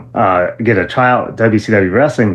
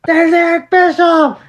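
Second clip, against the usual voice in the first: much higher, excited; starstruck; slower than usual. Retold or imitated. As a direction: gasp at spotting a celebrity. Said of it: "was like".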